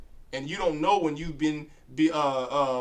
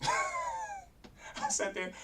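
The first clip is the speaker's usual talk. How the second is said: laughing in disbelief; 11 dB quieter, much slower than usual. Real, not acted.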